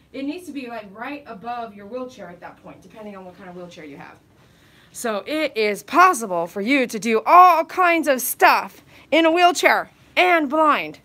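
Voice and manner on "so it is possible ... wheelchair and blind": loud, slow and insistent; loud, slow, higher on the last words; emphatic declaration. Real, not acted. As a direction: drive the point home to the viewers. Emphatic conclusion to viewers.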